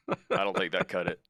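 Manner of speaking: usual speaking voice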